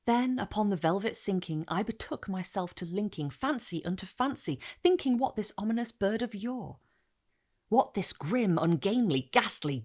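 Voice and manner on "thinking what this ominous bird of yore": ordinary delivery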